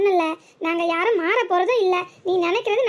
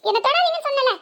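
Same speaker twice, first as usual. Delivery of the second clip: sharp raised scolding voice, pitched higher; angry scolding; 5 semitones higher than usual. Acted. Wife angrily scolding her husband for touching her. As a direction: snap at him for touching you. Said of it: "யாரையும் திட்டுற மாதிரி திட்டுற".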